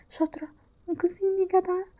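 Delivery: ordinary talk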